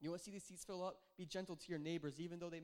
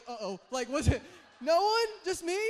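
excited loud aside; 8 semitones higher, 16 dB louder than usual. Real, not acted. Laughing at own bathroom story.

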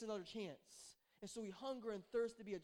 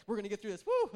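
louder mock cheer, self-encouraging; hammed-up pep talk; 13 dB louder than usual. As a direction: cheer yourself on, playfully. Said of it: "Could you tell that was like a faith comment".

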